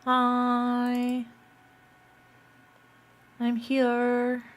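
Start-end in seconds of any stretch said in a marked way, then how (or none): none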